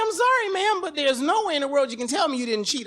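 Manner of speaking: high, loud scolding pastor; shocked pastor. Retold or imitated. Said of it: "he was like".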